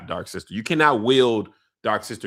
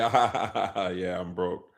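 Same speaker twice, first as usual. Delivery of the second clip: mocking laugh; 6 semitones lower, 4 dB quieter than usual. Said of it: "Once somebody say".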